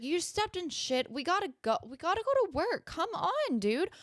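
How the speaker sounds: pleading with the dog to get to work; urging the dog. Retold or imitated. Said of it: "And I was like".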